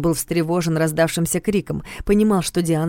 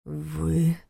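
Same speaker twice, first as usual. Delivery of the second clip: bewildered; 5 dB quieter than usual. Acted. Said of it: "отозвался Карпатский растерянно".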